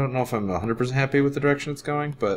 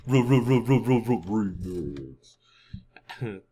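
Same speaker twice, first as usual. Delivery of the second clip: DJ stutter; 3 semitones lower, 4 dB quieter than usual. Pretend. DJ remix stutter bit.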